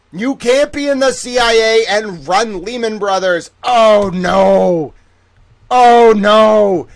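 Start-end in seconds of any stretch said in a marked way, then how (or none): 3.6-6.9 louder, higher cry of alarm; mock horror. Streamer reacting to chat's CIA reveal, higher and louder mock horror. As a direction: wail it like the sky is falling.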